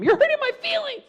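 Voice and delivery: high whining complaint; whiny victim. Acted. Hurt kid whines to the bully, high and plaintive. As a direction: play the hurt child.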